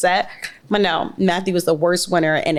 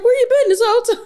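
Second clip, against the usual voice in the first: high, loud startled parent calling out; mock-shocked parent demanding an answer; exasperated parent; 4 dB louder, faster than usual. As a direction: play the parent who finally found the kid. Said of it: "And they're just like".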